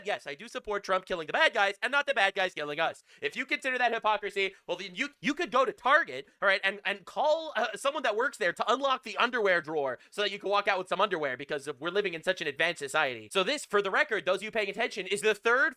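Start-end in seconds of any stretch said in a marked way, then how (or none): none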